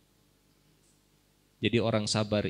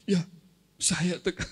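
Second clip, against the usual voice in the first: mock tough guy; 7 semitones higher than usual. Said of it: "Laki-laki nggak boleh nangis, harus tegar".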